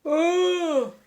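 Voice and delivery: sudden loud high oh; startled exclamation; surprised gasp. Real, not acted.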